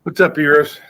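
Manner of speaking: big, loud hello; loud greeting. Real, not acted. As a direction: call out the greeting loudly. Loud greeting to a stream joiner.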